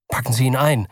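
ordinary delivery, nothing marked

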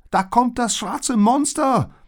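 very high, loud, drawn-out warning; alarmed shout. A narrator's reading. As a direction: shout that danger is coming. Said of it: "rief Liz". (laut und hoch Alarm rufen)

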